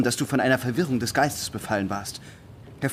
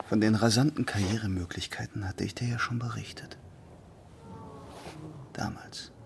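whispered; 7 dB quieter than usual. Acted. Heimliches Gespräch unter vier Augen.

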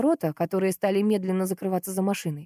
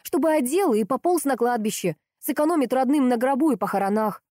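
higher-pitched irritated sarcasm; angry tirade; 6 semitones higher than usual. A narrator's reading. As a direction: keep scolding, pitch up. Сестра продолжает злую отповедь. (раздражённо, язвительно)

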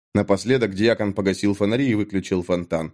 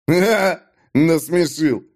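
high scornful ha-ha; mocking laugh; 6 semitones higher, slower than usual. A narrator's reading. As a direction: laugh him off with contempt. Dag mocks Natan. (Даг насмехается над Натаном)

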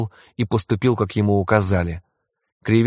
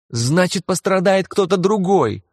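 much higher, shrill shriek; 9 semitones higher than usual. A narrator's reading. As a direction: squeal it out high. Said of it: "взвизгнула Кармен".

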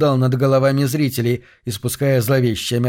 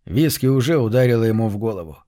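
usual speaking voice

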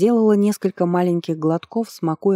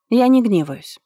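brushing it off, a bit higher; dismissive; 6 semitones higher, 4 dB louder than usual. A narrator's reading. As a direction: wave it away lightly. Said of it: "отмахнулась Махисат".

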